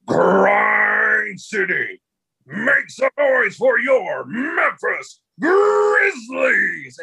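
very high, slow, drawn-out shout to the crowd; arena hype shout. Retold or imitated. Grizzlies announcer firing up the crowd, long hype shout. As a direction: pump up the whole arena, stretch every word.

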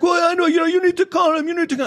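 high-pitched mocking woman's voice; high mocking imitation of the woman; mock nagging woman. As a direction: squeak it out like the pushy neighbor. Imitating the woman demanding he call.

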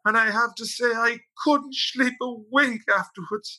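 put-on high, slow old lady voice; old woman complaining on the radio, high and slow; old woman's voice. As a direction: quaver it out like an old woman in her seventies. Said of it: "this old woman, I think in her seventies".

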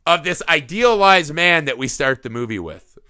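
ordinary talk, nothing marked

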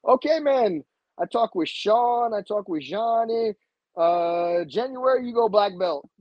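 imitating instructor. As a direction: announce the promotion slowly. Instructor announces promotion.

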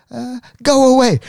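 put-on high, loud little-kid "Go away"; toddler go away. Uncle imitating his niece telling people off. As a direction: bark it like a cranky toddler. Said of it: "she'll be like".

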